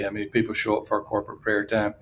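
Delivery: ordinary talk, nothing marked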